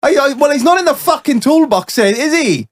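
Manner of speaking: put-on high-pitched, exasperated Welsh accent. Welsh workman searching his toolbox, arguing, Welsh accent. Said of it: "I'll do my best Welsh accent".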